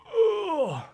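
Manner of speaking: high-pitched falling "ugh"; high groan. Real, not acted. Streamer reacting to an absurd message, high groan. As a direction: let out a high groan that slides down. Exclaims after absurd chat line.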